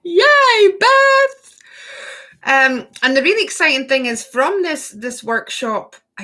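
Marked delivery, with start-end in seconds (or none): 0.0-1.6 loud, high-pitched whoop of delight; excited cheer. Real, not acted. Host celebrating Beth in the live chat, excited cheer. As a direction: cheer them on at full lift.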